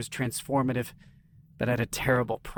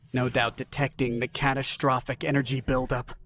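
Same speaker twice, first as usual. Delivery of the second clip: ordinary delivery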